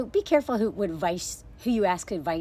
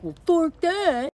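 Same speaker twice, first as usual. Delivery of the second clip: slow, higher and louder snarl, a hammed-up husband; mock angry husband; 7 semitones higher, 4 dB louder, slower than usual. Husband brushing off his wife's worry, mock angry snarl. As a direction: sneer it out slowly like a fed-up husband. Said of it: "his response is like".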